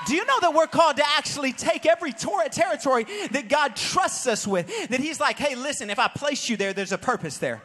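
loud, fast rising preacher call; full-force preaching. Acted. Pastor to his congregation, preaching at full force. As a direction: preach it hard to the crowd.

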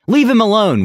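high-pitched protest from a crowd; narrated shout. A narrator's reading. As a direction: cry out in his defence. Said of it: "one shouted".

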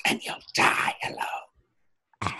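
slow ominous whisper. Retold or imitated. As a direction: whisper it slowly, like a threat. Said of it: "is what I assume it sort of sounded like".

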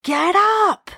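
a high, insistent inner voice; urgent voice. Retold or imitated. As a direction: lift the voice high to rouse her. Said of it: "that same voice saying".

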